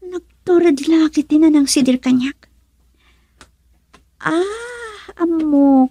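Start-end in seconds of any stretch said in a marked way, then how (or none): 4.2-5.9 crying sigh. Acted.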